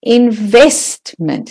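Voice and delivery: slow "investment" with a strong VEST; stress on VEST. Shown as a demonstration. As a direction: stretch the middle of "investment". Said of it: "the vest is the stressed syllable".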